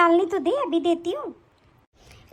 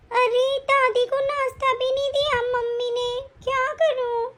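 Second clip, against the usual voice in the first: put-on little girl voice; 5 semitones higher, slower than usual. Child daughter complains.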